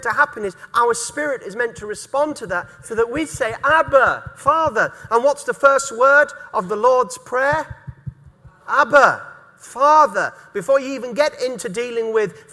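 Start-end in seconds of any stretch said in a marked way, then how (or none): 3.6-4.9 Abba, Father called out louder; fervent proclaiming. Acted. Preacher proclaims the cry to God. 8.7-10.3 Abba, Father called out louder; fervent proclaiming. Acted. Preacher proclaims the cry to God.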